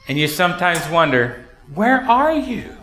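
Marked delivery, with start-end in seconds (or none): 1.7-2.8 pleading lament. Acted. Lament voiced in sermon.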